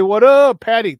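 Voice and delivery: loud, high greeting shout-out. Real, not acted. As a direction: call out to a viewer, loud and high. Shouting out a viewer.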